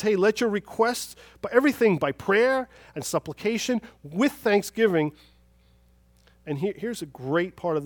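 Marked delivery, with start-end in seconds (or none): 0.0-5.1 high, loud scripture proclamation; emphatic preaching. Real, not acted. Preacher quoting Paul to the congregation, emphatic. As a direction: proclaim the verse to the whole room.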